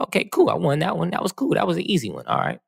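high, pleased gloating to himself; smug self-congratulation. Retold or imitated. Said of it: "I was like".

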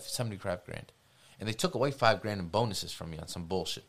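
ordinary talk, nothing marked

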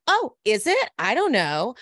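high, mock-clueless surprise; suspiciously innocent. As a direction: overplay not knowing, starting loud and high. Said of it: "Very, like, suspicious".

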